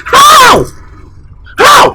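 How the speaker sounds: high, indignant one-word demand; outraged. Acted. Uncle outraged at his name being discussed, indignant. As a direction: pitch it way up in disbelief.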